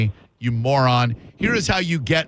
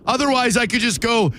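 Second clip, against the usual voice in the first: normal talking voice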